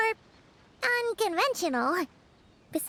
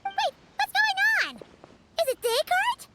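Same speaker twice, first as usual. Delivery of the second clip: surprised; 9 semitones higher than usual. Acted. Sees dog appear.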